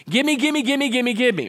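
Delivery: put-on high, loud childish pestering, falling at the end; mock greedy begging. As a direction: rattle it off like a demanding child. Mimics greedy begging in prayer.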